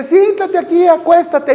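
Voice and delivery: high-pitched, mock-sweet invitation to lie down; voiced coaxing hostess. Preacher voices woman settling her tired guest, coaxing high voice.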